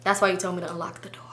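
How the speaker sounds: low, whispery aside; hushed realization. Real, not acted. Driver realizing why the door was unlocked, hushed. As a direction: drop to a near whisper.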